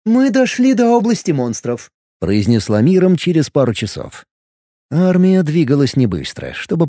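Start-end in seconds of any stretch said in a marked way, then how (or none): 0.1-1.9 much higher, otherworldly voice; high alien voice. Acted. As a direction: lift it high and strange. Mirom speaks, shifted voice.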